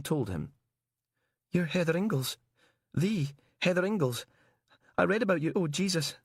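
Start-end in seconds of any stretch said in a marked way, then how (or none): 1.5-6.2 high, dawning dread; realizing the horror. Acted. Prisoner recognizing her.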